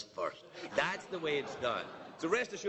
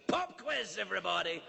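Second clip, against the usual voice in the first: high-pitched mock official call to the room; spring it on the crowd like an ambush; acted announcement, starting high and falling; surprise-raid announcement; 4 semitones higher than usual. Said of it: "suddenly circled this room right now and said".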